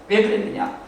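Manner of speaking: ordinary talk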